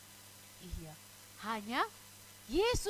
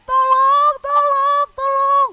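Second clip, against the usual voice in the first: high, loud acted cries for help; shouting for help; 12 semitones higher, 16 dB louder, slower than usual. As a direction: yell out as if stranded on the lake. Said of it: "mereka berteriak-teriak". (teriakan minta tolong yang nyaring)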